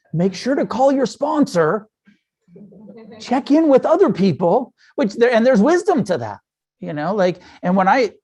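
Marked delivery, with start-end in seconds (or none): 0.1-4.7 lightly higher, mildly mocking sponsor-speak; recovery advice voice. Quoting the recovery saying about a bad neighborhood, lightly mocking and higher. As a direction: parrot the twelve-step advice with a light mock tone. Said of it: "that sort of recovery saying".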